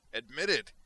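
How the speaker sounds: very loud, high, drawn-out boast; proud villain's roar. Acted. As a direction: bellow it with savage pride. Android boasts before laughing.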